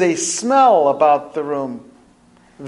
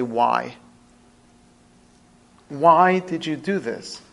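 pained questioning; 3 dB quieter, slower than usual. Acted. Mourner softly challenges God.

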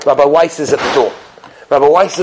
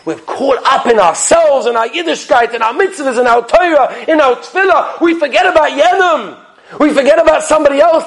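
fiery preaching; 7 semitones higher than usual. Acted. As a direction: drive it home like a rabbi at the pulpit. Preacher building to a climax.

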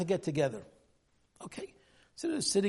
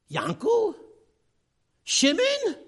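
much higher, louder cry of a name; shocked recognition; 9 dB louder, much slower than usual. Retold or imitated. Two fathers recognizing each other at the door, stunned high cry. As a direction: call out the name in stunned disbelief.

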